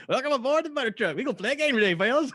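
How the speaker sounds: put-on loud, high North Georgian accent; hammed-up Southern truck host. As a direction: holler it in an excited Georgia accent. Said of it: "he's suddenly in the South".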